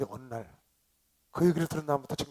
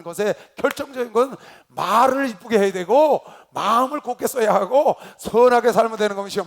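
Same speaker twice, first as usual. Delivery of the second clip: loud, raised preaching voice; preaching at full force; 5 semitones higher, 9 dB louder than usual. Real, not acted. Pastor urging congregation toward long life, preaching at full force. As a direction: drive the exhortation home at high volume.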